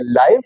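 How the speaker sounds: लाइव with a long "ai" vowel, like "alive"; saying live. Shown as a demonstration. Teacher shows students the pronunciation of लाइव, carefully. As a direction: say लाइव to rhyme with "five". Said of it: "pronunciation यानी इसकी sound अलग है".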